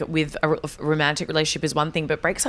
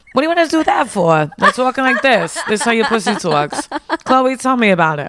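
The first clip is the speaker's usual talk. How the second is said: loud, hammed-up Queens woman; New York accent; 4 semitones higher, 4 dB louder than usual. Playing an old woman from New York, New York accent. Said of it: "I just love doing New York accents".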